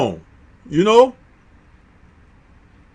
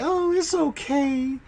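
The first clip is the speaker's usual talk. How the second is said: high, soft and slow, mildly unimpressed; wife politely unimpressed by the chicken place; 7 semitones higher, 12 dB quieter, slower than usual. Retold or imitated. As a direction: mimic the wife shrugging it off, softly and slowly. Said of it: "she's like".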